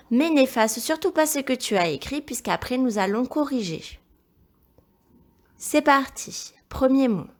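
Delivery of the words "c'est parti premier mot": ordinary talk, nothing marked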